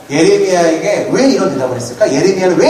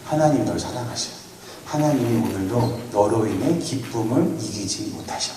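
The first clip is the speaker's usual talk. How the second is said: softer, earnest reassurance without the greasy tone; sincere encouragement; 6 semitones lower, 11 dB quieter than usual. Shown as a demonstration. Pastor to student Yebin, softly sincere and reassuring. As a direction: say it gently and sincerely to the girl. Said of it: "느끼하면 안 되는데".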